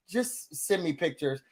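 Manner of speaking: tired, fed-up quote. Retold or imitated. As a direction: drop low and soft, worn out and done. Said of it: "that lady was tired of her".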